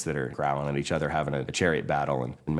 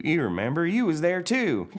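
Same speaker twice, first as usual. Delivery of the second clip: Mater voice; 12 semitones higher than usual. Acted. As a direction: tease it like Mater. Mater answers McQueen.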